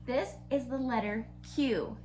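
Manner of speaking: usual speaking voice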